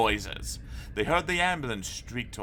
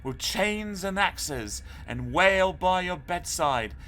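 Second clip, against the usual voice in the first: spooky ghost tale; 7 semitones higher than usual. A narrator's reading. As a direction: draw out each horror to frighten him. Arthur tells a scary ghost tale.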